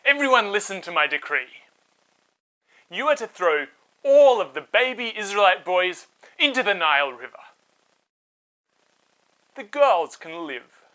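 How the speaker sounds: royal decree. Acted. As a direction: proclaim it to all Egypt. King proclaims decree.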